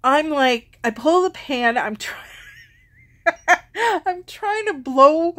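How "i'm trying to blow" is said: high laughing while telling it. Real, not acted. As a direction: laugh through it, slowly and higher. Laughing recalling the fire.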